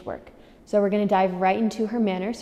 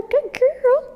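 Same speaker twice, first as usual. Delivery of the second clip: high squeaky praise. Real, not acted. Praising dog after exercise.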